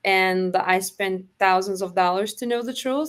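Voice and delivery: normal talking voice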